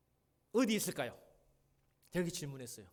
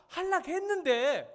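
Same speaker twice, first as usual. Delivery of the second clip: 하려고 stretched slow, much higher and louder; 하려고 drawn out like a complaining kid; whiny protest; 12 semitones higher, 10 dB louder, slower than usual. Retold or imitated. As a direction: drag out 하려고 in a high wail. Child stretching the word in protest.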